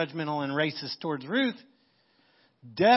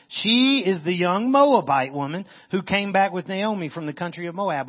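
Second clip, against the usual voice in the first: louder, higher, pointed reply; servant's answer; 4 semitones higher, 6 dB louder than usual. Acted. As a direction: stress her foreignness.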